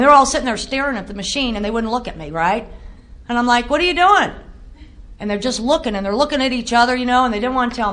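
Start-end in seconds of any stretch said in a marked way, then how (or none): 3.7-4.3 alarmed. Retold or imitated. Alarm at ultrasound.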